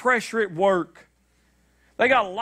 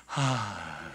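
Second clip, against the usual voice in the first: blissful sigh; 12 semitones lower, 9 dB quieter than usual. Retold or imitated. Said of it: "she's going".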